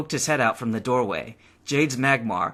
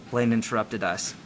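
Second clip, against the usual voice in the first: usual speaking voice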